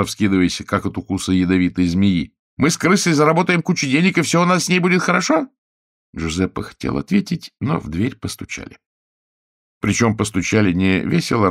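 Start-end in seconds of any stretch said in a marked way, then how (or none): 2.6-5.5 high, indignant mock repeat; mocking outrage. A narrator's reading. Chiara mocks Giuseppe's promise, high and indignant. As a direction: throw his words back, shrill and fast.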